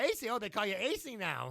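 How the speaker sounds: high, loud mocking wrestler voice; mocking Piper. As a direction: sneer the nickname back at him. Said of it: "so he's like".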